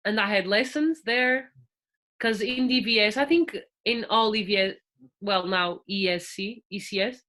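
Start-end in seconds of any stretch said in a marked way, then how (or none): none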